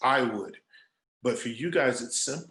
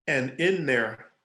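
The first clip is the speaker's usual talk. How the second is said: ordinary talk, nothing marked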